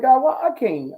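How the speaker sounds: high, loud mock plea to God; pleading complaint. Young preacher begging God for big words, pleading.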